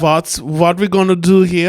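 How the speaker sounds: camp German accent. Shown as a demonstration. Coaching a friend on the accent, camp German.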